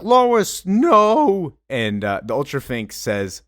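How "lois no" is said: high, loud, drawn-out cry; anguished hero's yell. Acted. Superman runs up as Lois is taken, desperate yell. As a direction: cry out as if she is being snatched away.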